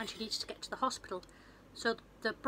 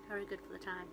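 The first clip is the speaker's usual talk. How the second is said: softer, lower repeat; quiet aside; 3 semitones lower, 7 dB quieter than usual. Shown as a demonstration. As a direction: mutter it again under breath.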